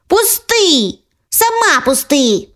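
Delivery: put-on high childish let go; two girls yanking a toy; tugging little girls. Sisters pulling the bear between them, high childish cries.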